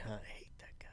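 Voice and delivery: breathy unvoiced whisper. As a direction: whisper it behind his back. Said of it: "you're like".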